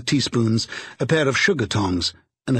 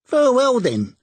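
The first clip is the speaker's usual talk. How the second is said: much higher, louder cry; crying out brazenly; 13 semitones higher, 4 dB louder than usual. A narrator's reading. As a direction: charwoman cutting them off. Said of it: "cried the woman".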